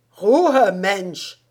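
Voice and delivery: shouted German order. A narrator's reading. Guard officer shouting the prisoner down, loud German bark.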